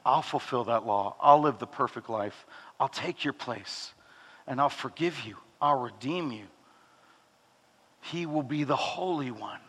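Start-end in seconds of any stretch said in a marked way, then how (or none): 0.1-6.5 tender promise. Acted. Pastor voicing Jesus promising to redeem the listener, soft and low. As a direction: lower and much softer, a calm sincere promise.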